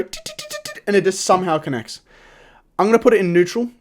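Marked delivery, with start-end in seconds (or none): 0.0-0.8 very high, soft staccato tit-tit-tit; quick little high-pitched ticks, imitating a game unit; imitated rapid ticking. Player mimicking how Golden Knight dashes, high soft ticking. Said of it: "it will just go".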